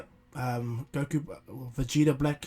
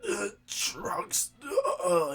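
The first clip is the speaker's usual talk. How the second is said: higher, strained groan of grief; pained groan; 6 semitones higher, much slower than usual. Acted. Vegeta groans in grief for Trunks left behind. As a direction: groan as if losing him.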